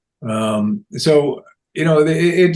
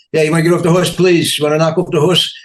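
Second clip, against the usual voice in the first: loud lisping Carlin cop voice; imitating Carlin's cop with a sibilant S; George Carlin impression; 5 semitones higher, 4 dB louder, faster than usual. Young fan copying Carlin's cop character, loud sibilant S. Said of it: "And I was talking like this".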